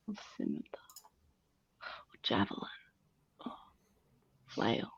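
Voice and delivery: unvoiced whisper to self. Real, not acted. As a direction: whisper the option under your breath. Muttering choice to self.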